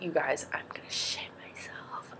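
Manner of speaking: hushed, whispered confession of fear; scared whisper. Retold or imitated. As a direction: whisper it like a panicky secret. Telling trainers she's scared.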